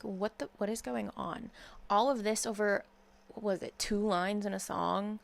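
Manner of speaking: ordinary delivery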